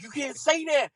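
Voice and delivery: high-pitched comic objection; mock protest. Golfer protests, retold.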